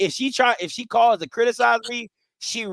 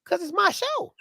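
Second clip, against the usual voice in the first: teasing retort; 13 semitones higher than usual. Acted.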